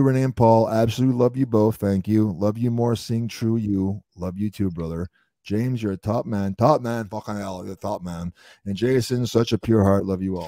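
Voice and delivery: usual speaking voice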